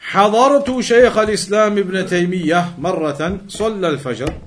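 quick, steady recitation of the Arabic passage; fast formal text reading. Acted. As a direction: read the classical text aloud briskly, like a scholar citing a source. Reads classical Arabic source text.

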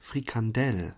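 Frikandel with the stress on the final DEL; stress on last syllable. Shown as a demonstration. Drilling German listeners, Frikandel stressed at the end. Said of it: "Die Betonung liegt auf der letzten Silbe".

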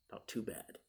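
ordinary delivery

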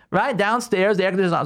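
normal talking voice

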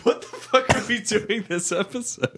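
high, laughing disbelief. Real, not acted. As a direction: laugh out the question. Laughing at silly banter.

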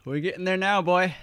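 ordinary talk, nothing marked

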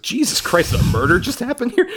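shocked exclamation. Retold or imitated. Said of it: "She's like".

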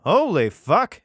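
can't believe what he just read; loud amazed exclamation. Real, not acted. Reacting to Samson slaying thirty men, loud amazement.

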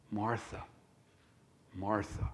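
soft, low calling of a name; gentle correction. Acted. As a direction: say the name low and tenderly, pausing between. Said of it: "gently encouraging and correcting".

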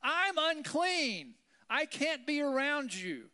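high, loud, slow yell; yelled warning. Retold or imitated. Leper warns people to keep away, slow loud yell. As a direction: warn them off, drawn out. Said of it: "you had to yell out".

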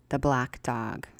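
ordinary delivery, nothing marked

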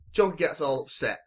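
ordinary talk

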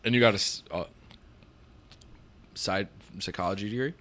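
talking through a burp; words pushed out on a burp. Real, not acted. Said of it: "just burped the whole way through that".

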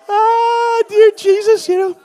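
high, loud, still laughing; laughing sigh. Real, not acted. Pastor catching his breath after the joke, laughing.

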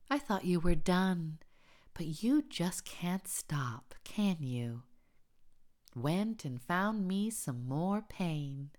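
mildly exasperated scolding, pitch swooping up; exasperated chiding. A narrator's reading. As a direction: chide them with a touch of exasperation. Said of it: "exhales in an exasperated, chiding way".

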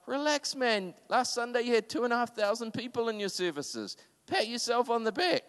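quoted reassurance, pitched well up; high-pitched retort. Retold or imitated. Someone reassures the pastor about Sunday's attendance, high-pitched retort. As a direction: raise the voice high to talk someone down.

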